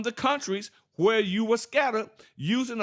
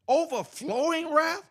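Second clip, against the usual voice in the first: incredulous; 7 semitones higher, slower than usual. Real, not acted. Reacting to the verse.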